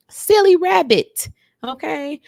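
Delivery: high, loud, drawn-out chiding; mock-exasperated, stretched-out tease; playful exasperation. Real, not acted. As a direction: scold a silly friend after a sigh. Chiding Hannah after a sigh.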